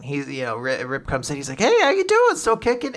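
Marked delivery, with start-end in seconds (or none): 1.6-2.9 high-pitched mock doctor greeting; cheery greeting. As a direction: greet the little patient high and loud. Doctor greets crying child, acted.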